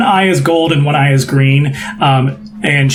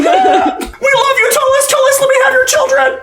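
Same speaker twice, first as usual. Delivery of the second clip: very high, squealing fangirl cries; hammed-up falsetto fans; adoring fan crowd. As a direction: squeal devotion like a swooning fan. Adoring crowd answers the host.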